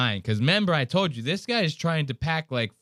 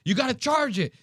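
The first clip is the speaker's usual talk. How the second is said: imitating yelling; 10 semitones higher than usual. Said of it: "He's yelling".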